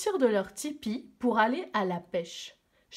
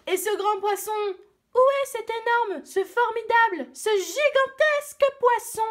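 excited crowd; 12 semitones higher, 7 dB louder than usual. Acted. Villagers eagerly demand the fish.

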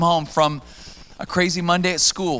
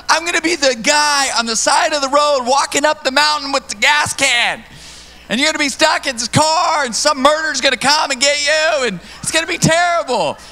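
mock panic; 10 semitones higher, 4 dB louder than usual. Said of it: "I go".

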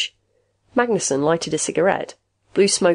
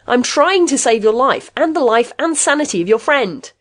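raised voice, high and urgent; exasperated shout; 7 semitones higher, 5 dB louder than usual. A narrator's reading. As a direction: shout sense into a stubborn man. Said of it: "Rath shouted".

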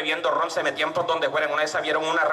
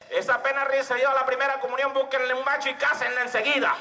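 mock drunk heckle; 7 semitones higher than usual.

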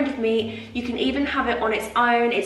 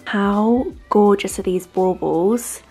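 ordinary delivery

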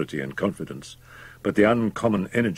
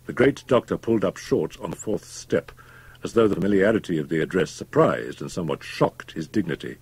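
ordinary delivery

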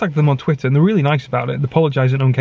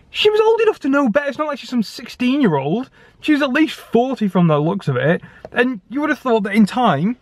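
worked-up rant, pitch much higher; getting angry; 8 semitones higher than usual. Real, not acted. Vlogger ranting at a rude Co-op cashier, angry and much higher. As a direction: vent about the rude shop assistant, rising higher. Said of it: "sorry, I'm getting angry now".

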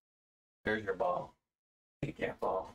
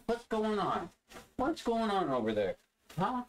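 high pet voice; 10 semitones higher than usual. Real, not acted. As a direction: ask the dog in a squeaky voice. Pet talk to dog.

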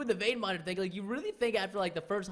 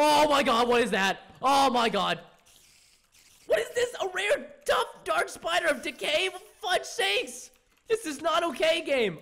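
panicked shock; 7 semitones higher, 6 dB louder than usual. Real, not acted. Rare spider appears.

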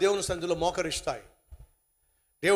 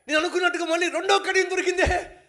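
higher, excited glee; higher and gleeful, as if his luck is holding; 10 semitones higher, 5 dB louder than usual. Acted. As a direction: rejoice over the second bangle. Man gleeful at second bangle.

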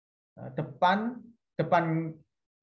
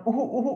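mock cough; 5 semitones higher, much faster than usual. Shown as a demonstration. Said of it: "pas batuk-batuk ya".

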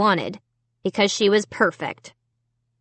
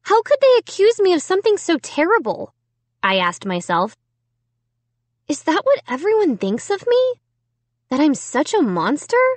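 hurt self-questioning; 8 semitones higher than usual. A narrator's reading. Girl asks herself, hurt.

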